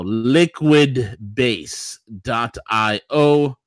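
"liquidbase dot I O" stretched slowly; slow, drawn out. Shown as a demonstration.

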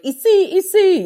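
high, loud mimicked cry; imitated urgent French calling. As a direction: call it out high and insistent, mimicking her.

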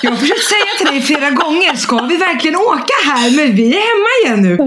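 patient husband. Retold or imitated. Husband answering calmly.

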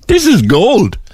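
high, bright "This is gold", no laugh yet; delighted. Real, not acted. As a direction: savour the story with delight. Host delighted by the pool story.

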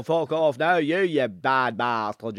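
thick, comic Cavan accent; exaggerated Cavan twang. Shown as a demonstration. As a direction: rant in a broad Cavan accent. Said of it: "you start heading up the Cavan and they go".

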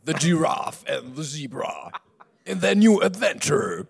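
slow, dramatic trailer announcer; movie trailer voice. Acted. Director's Cut.

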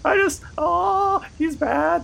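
high squeaky clown voice; whiny high-pitched clown; silly clown. Acted. Clown voice continues.

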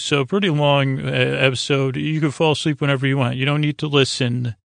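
usual speaking voice

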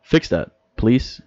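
normal talking voice

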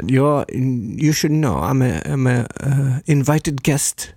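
put-on slow English with a light German accent; mock German-accented English. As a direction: imitate a German woman's accented English, slowly. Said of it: "på en tysk brytning".

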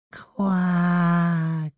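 high, drawn-out toad quack. Acted. Toad Glenda answers, a high drawn-out quack. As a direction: croak it like a little toad.

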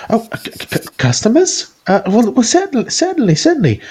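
very high, stammering surprised voice; flustered woman. Acted. Shopkeeper woman startled by customers, high flustered voice.

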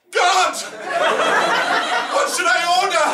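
calling out. Pretend. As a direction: call up to heaven desperately. Calling to God again.

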